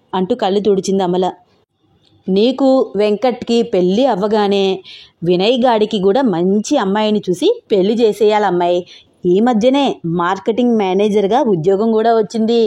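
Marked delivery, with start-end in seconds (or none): none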